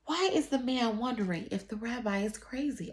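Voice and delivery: usual speaking voice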